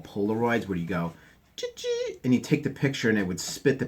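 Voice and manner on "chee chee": mock camera chirp. Mimicking Polaroid camera sound.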